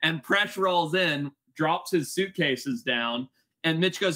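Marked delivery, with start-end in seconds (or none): none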